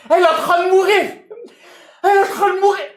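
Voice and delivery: high, loud frantic cry; panicked shout. Retold or imitated. Retelling his yell to bystanders, high frantic shout. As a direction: shout it like someone is dying in front of you.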